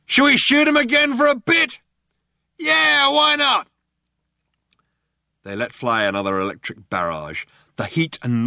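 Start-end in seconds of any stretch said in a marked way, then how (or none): 0.1-1.8 high, loud shouted question; shouting cop. A narrator's reading. One cop to the other proposing more shooting, shouting. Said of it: "One cop shouted to the other". 2.6-3.7 high, loud answering shout; shouting cop. A narrator's reading. Second cop agreeing to shoot again, shouting.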